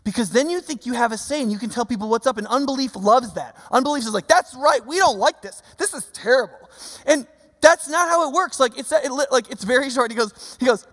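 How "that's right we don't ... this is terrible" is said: loud, high-pitched protest; hammed-up righteous complaint at full volume; mock indignation. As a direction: play the outraged objector, punching each phrase. Voices unbelief as an indignant crowd.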